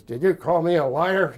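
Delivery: imitating an angry instructor confronting a cadet; in-your-face challenge. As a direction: get right in his face with it. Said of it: "gets in my face and says".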